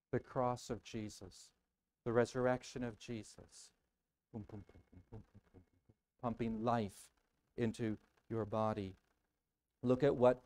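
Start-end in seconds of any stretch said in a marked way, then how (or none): 4.3-5.9 low, very soft, steady booms; imitated heartbeat. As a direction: thump softly like a beating heart.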